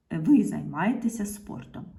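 ordinary talk

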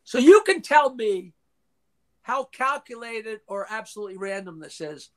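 ordinary delivery

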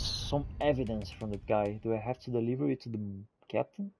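ordinary talk, nothing marked

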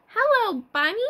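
high, loud, bright hello; cheery book greeting. Retold or imitated. Teacher reading the book's greeting to toddlers, high and loud. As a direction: greet the bunny like a friend. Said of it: "And it says".

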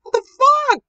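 scared shriek. Real, not acted.

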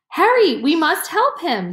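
high, loud, urgent call for help; urgent cry. A narrator's reading. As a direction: shout for help, the dog is dying. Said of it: "he cried".

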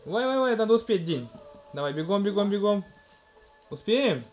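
usual speaking voice

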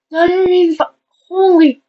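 loud, high exclamations; amazed. Real, not acted. Vlogger finding the numbers, loud amazed outburst. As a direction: burst out in amazement.

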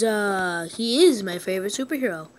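usual speaking voice

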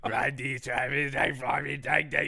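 hammed-up Cockney babble; indecipherable Cockney. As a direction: stretch the vowels like a thick Cockney cabbie. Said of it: "it was so thick".

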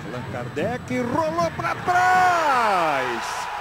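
slow, stretched shout of the build-up; explosive goal commentary. Real, not acted. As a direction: belt it out as the goal goes in. Goal replay, live play-by-play.

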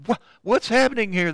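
a hurried, fearful cry of confusion; startled cry. Acted. Frightened woman crying out at an unexpected power, startled. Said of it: "She is afraid. She cries out".

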